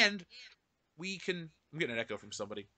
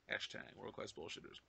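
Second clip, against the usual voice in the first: whisper; 10 semitones lower, 15 dB quieter than usual. Acted. Whispered tagline.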